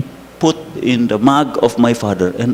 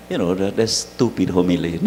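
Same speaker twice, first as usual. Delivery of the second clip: self-mocking laugh; 8 semitones lower, 8 dB quieter than usual. Real, not acted. Mocking his own homily.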